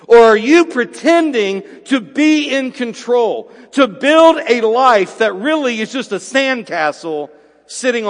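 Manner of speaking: fervent sermon climax, hammering every word; preaching at full force. Acted. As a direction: drive each phrase home from the pulpit. Preaching the central question.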